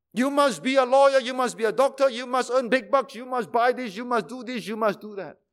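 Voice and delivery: imitating a pushy parent. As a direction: lay down the demands. Parents voiced pushing children.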